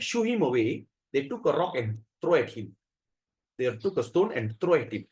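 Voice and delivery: ordinary delivery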